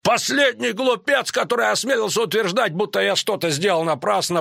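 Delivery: high-pitched indignant tirade; Крахенкрайт ranting. A narrator's reading. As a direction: rage at a rival before the hall. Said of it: "неожиданно рявкнул Крахенкрайт".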